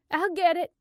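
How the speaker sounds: high, loud call. Acted. Karen to the household from the stairs, called out loudly. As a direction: shout it to the household.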